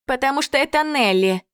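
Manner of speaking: high, loud scoff at Nelli; snorting, scornful. A narrator's reading. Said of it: "фыркнула рыжая".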